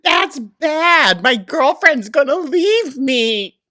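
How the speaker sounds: high, loud, slow mock wail; hammed-up panicked girlfriend worry; whiny mock panic. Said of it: "She's like".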